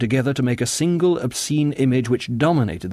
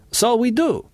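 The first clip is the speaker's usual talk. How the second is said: high-pitched agreement; high, emphatic character voice; assenting singer; 13 semitones higher than usual. A narrator's reading. Singer answers John.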